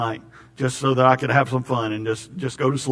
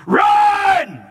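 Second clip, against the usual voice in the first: sudden much higher, loud shout; 6 dB louder than usual. Real, not acted. Preacher warns congregation about false pastors, shouting. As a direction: yell it as a real warning.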